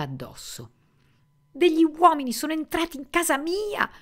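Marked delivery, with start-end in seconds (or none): 1.5-3.9 high, loud alarmed cry; frightened shout. Acted. Susan on the phone to Warren in the night, screaming. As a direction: yell it out in shock and outrage. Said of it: "gridò".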